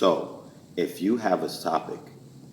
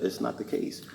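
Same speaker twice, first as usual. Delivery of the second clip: normal talking voice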